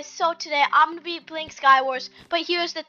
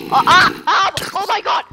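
startled panicked yelps; panicking gamer; 4 semitones higher, 7 dB louder than usual. Real, not acted. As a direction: yelp in sudden fright. Scary mob appears suddenly.

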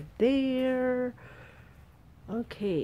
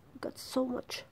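soft whispered aside; whispering; 5 semitones higher, 7 dB quieter than usual. Real, not acted. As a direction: whisper it to yourself. Whispered aside about plants.

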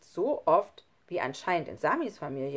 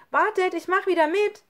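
put-on high, loud girl's call; 12 semitones higher, 9 dB louder than usual. Nele running back to join the game, calling loudly. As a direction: shout to the others to wait for her. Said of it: "rief sie".

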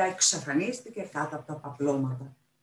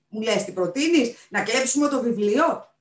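higher, louder indignant questions; angry retort; 6 semitones higher, 8 dB louder than usual. A narrator's reading. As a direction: throw the question back angrily. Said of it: "θύμωσε η Μάρθα Ρουθ".

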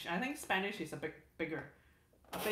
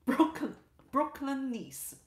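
put-on Brooklyn accent; 4 semitones higher, 4 dB louder than usual. Streamer reading a chat comment, light Brooklyn accent. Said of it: "in addition to English, I speak".

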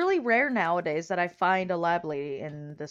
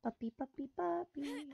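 soft, higher coaxing call; calling a puppy; 5 semitones higher, 13 dB quieter than usual. Pretend. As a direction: coax a puppy over quietly.

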